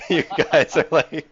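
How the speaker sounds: laughing hard while talking. Real, not acted. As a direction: squeeze words through laughter. Laughing at spamming lock.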